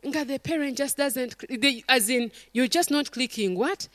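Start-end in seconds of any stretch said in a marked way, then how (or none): none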